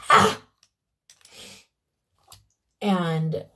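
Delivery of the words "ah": piercing high shrieking cry, much higher and louder; terrible cry. Acted. Baba Yaga shrieks on hearing the right number, piercing cry. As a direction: let out a witch's terrible shriek. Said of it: "out a terrible cry like".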